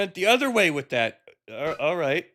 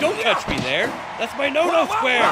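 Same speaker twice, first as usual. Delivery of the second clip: mock meme quote; 7 semitones higher than usual. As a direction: squeal it like a cartoon meme. Quoting meme voice over fight.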